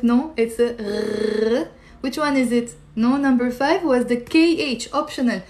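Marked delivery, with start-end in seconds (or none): 1.6-1.7 long gargled "r" in the back of the throat; gargling sound. Shown as a demonstration. Teacher correcting students' guess, "r" as a gargle. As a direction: draw out "r" like gargling. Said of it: "gargling is not number five".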